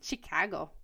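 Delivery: Chicago-style Midwest accent; mock Midwest accent on the place name; Midwestern accent. Shown as a demonstration. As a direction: put on a Midwestern twang. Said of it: "that's their accent".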